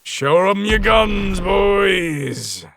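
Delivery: slow, loud rallying cry to the crew; pirate captain rallying. Acted. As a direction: rouse the crew for the fight. Rallying crew before boarding.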